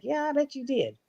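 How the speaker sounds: high, soft wry reply; high mock-sarcastic mother. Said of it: "And I was like".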